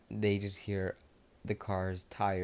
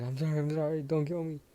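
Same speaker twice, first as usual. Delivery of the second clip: pleading; 8 semitones higher, 3 dB louder, faster than usual. Acted. Bakugo begging Discord for mercy.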